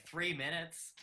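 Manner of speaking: incredulous. Retold or imitated. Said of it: "I was like".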